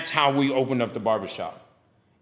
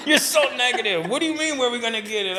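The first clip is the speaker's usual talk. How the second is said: teasing through laughter, higher; laughing teasing; 10 semitones higher than usual. Real, not acted. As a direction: tease her while still laughing. Laughing while retelling quote.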